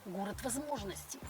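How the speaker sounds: ordinary delivery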